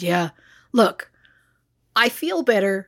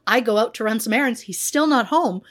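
ordinary talk

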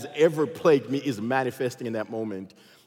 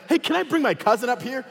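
innocent asking; 7 semitones higher than usual. Retold or imitated. Innocent request to counter agent.

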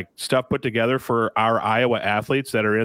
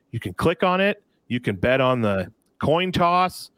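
ordinary delivery

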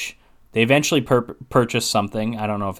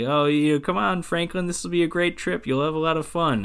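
mock her coaxing with a raised, put-on voice; mocking Sally voice; 6 semitones higher than usual. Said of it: "he's doing a Sally voice".

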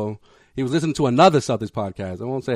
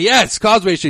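excited confirmation; 6 semitones higher than usual. Retold or imitated. Retold fan confirming the podcast's name.

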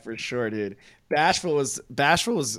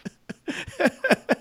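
high burst of laughter. Real, not acted. Big laugh.

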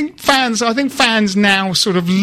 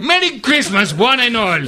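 put-on Luis Boa Morte accent. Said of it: "by attempting the accent".

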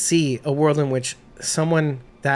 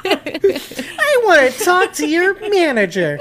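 higher, slow, laughing entitled complainer; mock demanding customer; 14 semitones higher, 4 dB louder, slower than usual. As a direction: ham up asking for the manager.

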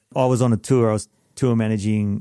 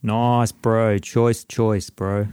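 mock Kiwi slang; much slower than usual. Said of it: "See what I did there?".

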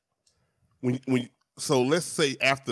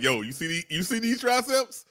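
show-off bro; 12 semitones higher than usual. Retold or imitated. He acts out his ab selfie caption, show-off bro. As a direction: brag about the triceps like a gym bro. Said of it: "you got to be like".

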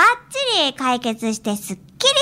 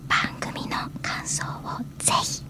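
whispering; 7 semitones lower, 6 dB quieter than usual. Shown as a demonstration. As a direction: breathe the words softly into the mic. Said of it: "番組情報をささやき声でお知らせするパターンです".

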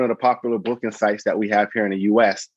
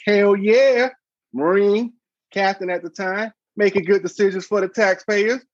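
hyped self-cheer; 10 semitones higher than usual. Retold or imitated. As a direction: pump yourself up like a winner. Cheering himself on in his head.

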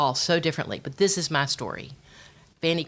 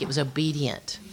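usual speaking voice

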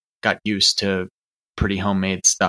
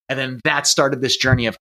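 normal talking voice

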